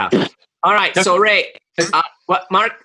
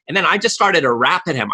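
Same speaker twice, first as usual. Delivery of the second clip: ordinary talk, nothing marked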